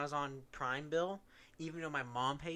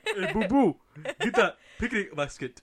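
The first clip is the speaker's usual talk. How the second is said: much louder, laughing cartoon bear voice; louder Yogi Bear impression; 6 semitones higher, 13 dB louder than usual. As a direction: belt out Yogi asking Boo Boo. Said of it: "trying to do a Jamaican accent".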